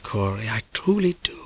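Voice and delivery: whispered threat; creepy whispered reassurance; sinister whisper. A narrator's reading. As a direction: hush it with false sincerity.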